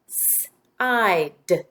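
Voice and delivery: "S-I-D" as separate sounds, sss, eye, d. Shown as a demonstration. Teacher gives the sounds in second syllable, segmented phonemes. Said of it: "Now the sounds in the".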